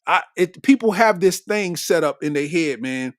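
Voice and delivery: usual speaking voice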